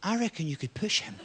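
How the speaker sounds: a touch of a sneaky evil whisper; evil inner voice. Retold or imitated. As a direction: tempt yourself quietly. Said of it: "a part of your brain goes".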